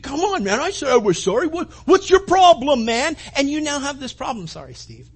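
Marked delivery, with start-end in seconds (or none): 0.0-3.2 mildly raised, indignant complaint; a touch of huffy attitude; copping an attitude. Retold or imitated. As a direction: push back at the driver. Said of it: "cop this attitude".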